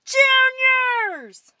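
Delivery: very high, sustained yell; shouted roll call. A narrator's reading. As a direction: summon the next class loudly. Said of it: "Alfred jumped up to take over".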